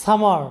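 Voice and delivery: সামার with one single m, ending "ar"; pronunciation demo. Shown as a demonstration. Teacher models সামার with single m, pronunciation demo. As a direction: say সামার as sa-mar, the m not doubled. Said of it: "তাহলে আমাদের উচ্চারণটা হবে".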